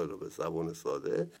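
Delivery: ordinary delivery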